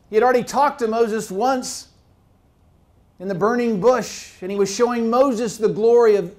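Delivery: loud, rising sermon voice; fiery preacher building up; preaching at full force. Acted. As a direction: preach it out to the whole church.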